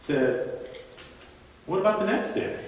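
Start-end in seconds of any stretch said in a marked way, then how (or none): 1.7-2.6 pitched-up teasing repeat; mock-innocent buddy needling Peter; needling question. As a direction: poke him one more time. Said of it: "he can get under Peter's skin".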